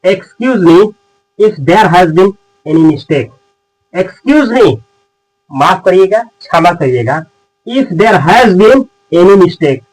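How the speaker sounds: slow, clear word by word; slow model phrase. Shown as a demonstration. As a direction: spell it out for the class. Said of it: "आप बोलिएगा".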